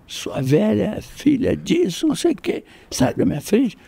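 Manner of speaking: high, slow, sneering bully voice; mocking bully. Schoolyard bully shoving past an old lady, high sneering drawl.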